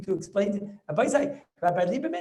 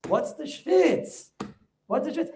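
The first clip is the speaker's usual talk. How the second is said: mock puzzled; 6 semitones higher, slower than usual. Rebbe mocking the sweating.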